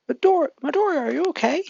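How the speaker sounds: high, loud anxious call of his name; worried excited call. Acted. Classmate bursting in to check on him, high worried call. Friend rushes to worried Deku.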